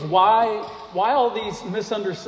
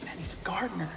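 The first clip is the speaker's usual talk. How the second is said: much softer, breathy whisper of a private realization; whispered thought; 13 dB quieter, faster than usual. Acted. Mary at the tomb, whispering her thought to herself. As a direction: let them overhear Mary thinking. Mary's inner thought voiced by preacher.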